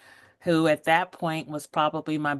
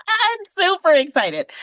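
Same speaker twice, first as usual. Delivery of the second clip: much higher and louder, squealing with joy; super excited; 12 semitones higher, 6 dB louder than usual. Real, not acted. Telling the host she's thrilled to be on, gushing excitedly. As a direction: let the excitement spill over. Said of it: "I'm trying to calm down".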